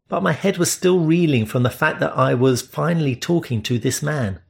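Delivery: usual speaking voice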